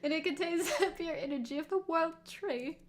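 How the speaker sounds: high put-on Victorian British boy accent, breaking into laughter; giggling through a hammy British boy accent; bad Victorian British boy accent. Streamer laughing while reading the line in a bad British boy accent. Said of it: "the really bad Victorian British boy accent".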